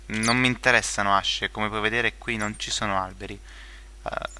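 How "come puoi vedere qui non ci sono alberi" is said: ordinary talk, nothing marked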